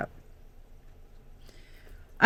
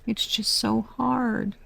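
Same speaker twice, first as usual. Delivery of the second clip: ordinary talk, nothing marked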